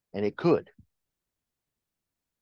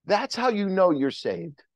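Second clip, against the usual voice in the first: usual speaking voice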